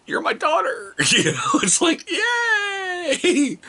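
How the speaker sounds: high wailing mock cry; high mock-crying yay; mock crying cheer. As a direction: cry out in hammed-up delight.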